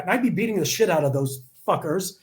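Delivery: normal talking voice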